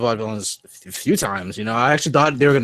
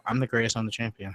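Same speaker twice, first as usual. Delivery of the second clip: "I'm the greatest, I'm the champion" mumbled low and much softer, no punch; flat, lifeless delivery; 4 semitones lower, 10 dB quieter, faster than usual. Shown as a demonstration. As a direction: say it like a softy with no bass. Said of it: "without any inflection or funny voice".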